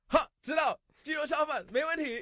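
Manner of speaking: high, loud cheery shopkeeper reply; shop owner voice. Retold or imitated. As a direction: boss shouting a cheerful yes. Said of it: "And then Lao Man was like".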